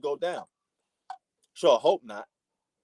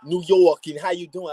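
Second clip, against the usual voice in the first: mock Italian-American New York accent, louder and higher; Italian New Yorker accent; 4 semitones higher, 8 dB louder than usual. LA person asking a New Yorker for the accent, mock Italian New York accent. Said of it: "I'm not an Italian New Yorker".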